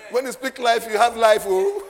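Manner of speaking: loud, high and laughing; exultant preaching. Real, not acted. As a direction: proclaim it joyfully through laughter.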